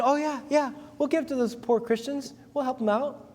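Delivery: high, breezy fake eagerness; mock eager promise. Pastor mimics Corinthians promising to give, breezy and high. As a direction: chirp it like empty promising Corinthians. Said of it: "He said".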